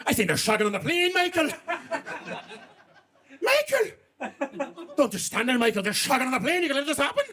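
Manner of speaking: hammed-up Irish woman urging her husband to act; scandalised Irish accent. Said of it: "they had a very thick Irish accent".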